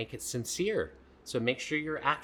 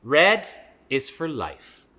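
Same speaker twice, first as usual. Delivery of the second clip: put-on reading to children; 2 semitones higher, 8 dB louder, slower than usual. Reading a page aloud.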